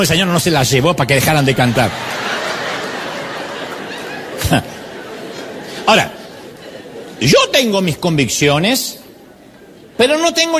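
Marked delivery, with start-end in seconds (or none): 2.0-5.8 risa ahogada. Real, not acted. Se ríe de su propia burla.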